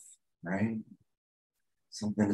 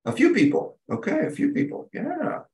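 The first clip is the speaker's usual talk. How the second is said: high amused surprise. Real, not acted. Counting raised hands, amused.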